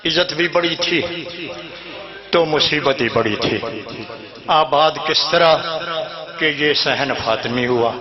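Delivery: hushed lament for a mourning home; grieving recital. Acted. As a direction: sink the voice in grief for the household. Mourning verses for the family.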